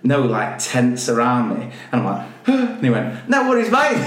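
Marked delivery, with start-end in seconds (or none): 3.3-4.0 high, exaggerated Aussie twang; mock Australian. Imitated snake handler reassuring guest, mock Australian accent. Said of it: "He wasn't Australian".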